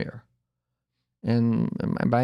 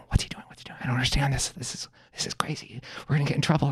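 put-on hushed, whispered kid panic; anxious whisper; 3 semitones higher, 6 dB quieter, faster than usual. Sister and brother fret behind dad, whispering. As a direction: imitate children fretting under their breath.

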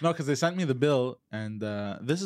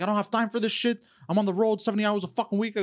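retold swearing; 8 semitones higher, faster than usual. Caller swearing at the agent on the phone, angry. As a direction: vent at her, higher and faster. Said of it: "I started swearing at her".

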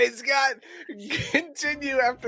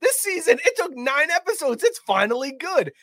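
higher-pitched thrilled "finally"; gleeful surprise at the show; excited reaction; 8 semitones higher than usual. Retold or imitated. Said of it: "I was like".

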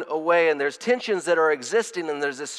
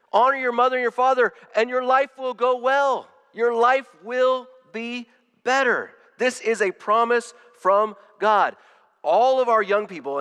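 raised, high-pitched proclamation; emphatic preaching; 8 semitones higher, 4 dB louder than usual. Real, not acted. Preacher declaring God's promise to the congregation, emphatic and raised.

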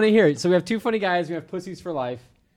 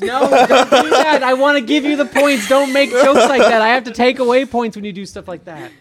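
raised, higher-pitched telling-off; exasperated scolding; 6 semitones higher, 4 dB louder than usual. Real, not acted. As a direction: scold them like a fed-up host. Host scolds contestants over team jokes.

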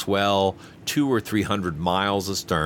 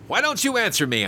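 high, sharp demand for an answer; rough demand; 13 semitones higher than usual. A narrator's reading. Passenger demands an answer from the silent steward, roughly. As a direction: bark the question at him. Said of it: "I said roughly".

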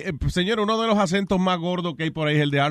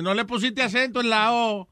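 nitpicking caller; 5 semitones higher, 3 dB quieter than usual. Retold or imitated.

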